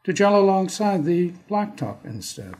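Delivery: measured, dramatic poem recital with long pauses; poetry reading. Acted. Poet reading his Toronto poem for podcast listeners, measured and dramatic. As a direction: perform the poem, letting each image land.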